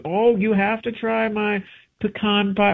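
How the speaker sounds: high-pitched pushy host voice; insistent relative. Acted. Relative offering homemade pie at a gathering, high insistent voice. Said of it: "the family or a friend".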